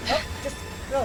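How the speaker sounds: high frantic yell; desperate, urging shout; being chased. Real, not acted.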